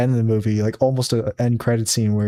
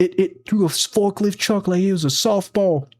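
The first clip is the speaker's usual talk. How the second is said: movie line quote; 7 semitones higher than usual. Retold or imitated.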